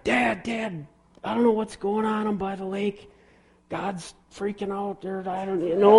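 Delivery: high-pitched, frantic retold phone plea; hammed-up high panic; mock panic. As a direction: act out a panicked son calling his dad. Retold panicked night call to dad.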